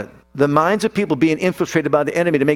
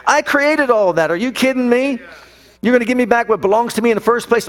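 defiant Jesus; 7 semitones higher than usual. Acted. Jesus answers devil in sermon.

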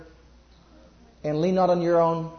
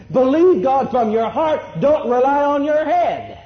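louder and slower, driving each word home; emphatic preaching; 6 semitones higher, 5 dB louder than usual. Real, not acted. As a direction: preach it like a command from the pulpit. Emphatic Bible quote to congregation.

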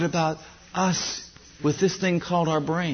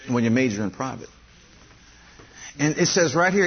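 ordinary talk

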